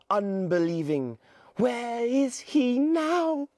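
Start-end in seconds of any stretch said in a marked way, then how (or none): 1.6-3.5 put-on high, slower worried boy; Piggy asking again, slowing down; a hint of Piggy, anxious; lightly voiced Piggy. Piggy insists.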